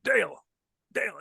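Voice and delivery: called out high across the field; calling out a name. Retold or imitated. Manager calling to the umpire from the dugout, high call. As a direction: call his name to get his attention.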